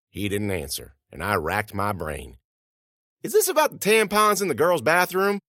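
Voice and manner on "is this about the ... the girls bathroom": high-pitched, blurted question; anxious guess. Acted. Student guesses to vice principal why they're in trouble, anxious guess.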